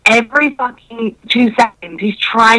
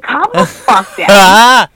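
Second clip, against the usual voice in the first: slow, louder, drawn-out calm the fuck down; exasperated put-down; 5 dB louder, much slower than usual. Retold or imitated. Retelling telling her date, slow and louder, to calm down. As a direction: lean on each word, fed up.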